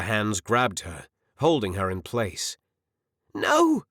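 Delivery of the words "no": high, loud scream of protest. A narrator's reading. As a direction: shriek it, desperate and high. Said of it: "she screamed".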